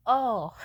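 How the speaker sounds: sharp loud pained oh; the hit lands, blurt it out; pained grunt. Retold or imitated. Slamming head on table corner.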